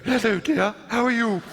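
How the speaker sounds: put-on high ingressive elderly lady voice; breathing-in voice. Impersonating elderly speech coach to young pupil, breathing in as she speaks. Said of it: "she was breathing in when she spoke".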